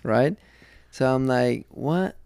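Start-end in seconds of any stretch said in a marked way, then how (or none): 1.7-2.2 higher, questioning "what"; retold disbelief. Husband retelling finding a condom in the car, incredulous. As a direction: toss out a puzzled, rising what. Retelling his reaction to the find.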